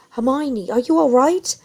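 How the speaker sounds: put-on worried father checking on the children; shaky, worried. A narrator's reading. Mr Weasley checking on Hermione, shaky and anxious. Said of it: "his voice sounding shaky".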